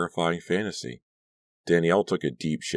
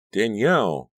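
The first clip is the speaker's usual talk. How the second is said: calling out; 7 semitones higher, 6 dB louder than usual. A narrator's reading. Caroline calls to wake her.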